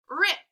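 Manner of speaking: "rip" said alone with a popped final "p"; final sound stressed. Shown as a demonstration. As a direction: release the "p" of "rip" as a puff. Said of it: "really listen to my words".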